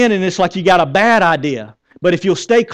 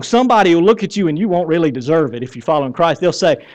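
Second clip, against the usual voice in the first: ordinary delivery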